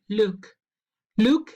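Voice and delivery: "look" said alone, ending on a released k; final k shown. Shown as a demonstration. Teacher shows the verb's final sound, "look" ending on k. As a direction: say "look" as a separate word closing on k. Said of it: "Se termina con el primer sonido en cat".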